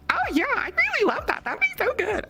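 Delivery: put-on very high, breathy fan girl voice; squeaky sweet girl voice; high girly voice. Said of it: "for Ari, I go".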